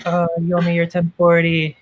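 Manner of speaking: hammed-up bedroom voice; mock seductive. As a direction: purr it like dirty talk. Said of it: "We're like".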